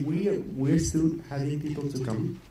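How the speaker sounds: ordinary talk, nothing marked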